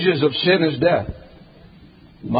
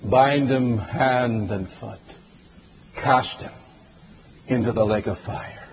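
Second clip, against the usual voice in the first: stern Lord's sentence; 5 semitones lower than usual. Acted. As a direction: pronounce the sentence without pity. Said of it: "the Lord simply says".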